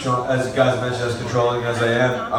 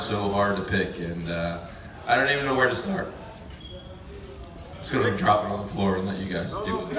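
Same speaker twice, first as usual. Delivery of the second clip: ordinary delivery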